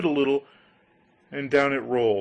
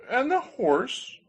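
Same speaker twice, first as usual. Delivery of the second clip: questioning grinder voice; 12 semitones higher, slower than usual. Acted.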